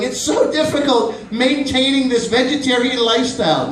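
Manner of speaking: high, mock-suffering whine; whiny fake vegetarian. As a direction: lament it, pitched high. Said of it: "and going".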